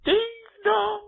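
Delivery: high sing-song doorbell chime; loud, high imitation of a doorbell.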